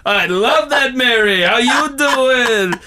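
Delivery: slow, drawn-out Joey catchphrase; Joey from Friends. Retold or imitated. Host acting Joey's reply to Mary, slow drawn-out Joey. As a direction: lean into the Joey drawl. Joey voiced in retold nativity.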